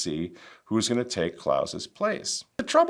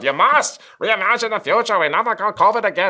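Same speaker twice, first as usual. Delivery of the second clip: higher, louder mock German accent, sped up at the end; mock Klaus Schwab; 9 semitones higher, 6 dB louder than usual. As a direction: lampoon a German technocrat lecturing the world. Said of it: "Remember Klaus Schwab?".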